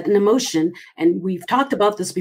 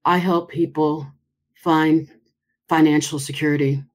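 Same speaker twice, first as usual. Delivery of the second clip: hesitant, unconvincing; 5 semitones lower than usual. Shown as a demonstration. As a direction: drag it out so it sounds unsure. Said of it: "You have to have a smile".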